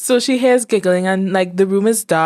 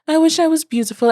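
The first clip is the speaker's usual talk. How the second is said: put-on whiny girl wish; 10 semitones higher, faster than usual. Sulking girl voiced in story.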